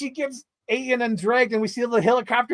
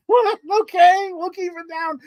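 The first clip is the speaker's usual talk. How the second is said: high, loud mock-compliant okay; barely holding back laughter; laughing at the usher's request; laughing reply; 11 semitones higher, 3 dB louder than usual. Replies to usher while laughing.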